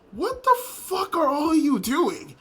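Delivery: high, drawn-out exasperated outburst; mock outrage. Acted exasperated outburst at the group.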